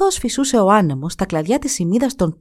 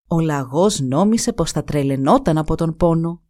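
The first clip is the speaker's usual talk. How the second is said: ordinary delivery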